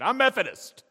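loud, high, huffy imitation of a householder; mock indignant shout. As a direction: bark it back like an offended neighbor. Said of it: "that's how they said it too".

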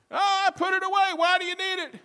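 high, loud falsetto; wife yelling back. Acted. Wife challenging husband from another room, falsetto yell. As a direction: argue back in a shrieky wife voice.

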